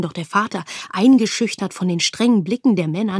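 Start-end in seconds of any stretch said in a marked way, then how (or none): none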